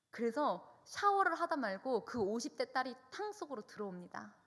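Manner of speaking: normal talking voice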